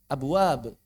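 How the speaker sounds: "Abwab" released with a bouncing b; easy "Abwab", echo after each b; with qalqalah. Shown as a demonstration. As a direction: let the b bounce lightly in "Abwab". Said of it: "nggak dikolkolahin".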